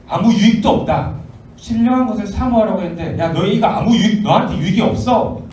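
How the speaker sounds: emphatic rebuttal. Acted. Apostle warning believers their gifts gain nothing, emphatic. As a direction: drive home that it is worthless.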